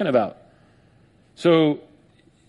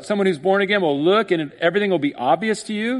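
normal talking voice

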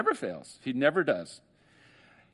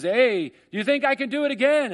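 higher, louder tightrope walker calling to the crowd; showman's call; 11 semitones higher, 6 dB louder than usual. Retold or imitated. Tightrope walker to the cheering crowd, a higher, louder call. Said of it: "he comes back and he says".

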